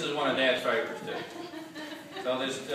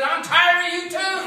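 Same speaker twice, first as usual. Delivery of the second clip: high loud reply, a bit slow; wife's loud misheard retort; shouted punchline; 10 dB louder, slower than usual. Retold or imitated. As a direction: fire it back across the house.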